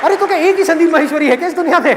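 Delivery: high-pitched indignant retort; hammed-up rising exasperation; exasperated daughter. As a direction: snap back at dad in mock outrage. Acted daughter retorts to father.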